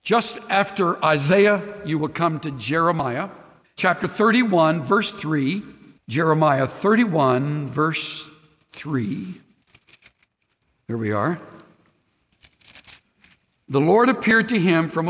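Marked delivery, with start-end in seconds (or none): 6.1-9.4 slow repeat. Real, not acted. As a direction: spell out verse then three. Pastor repeats the reference.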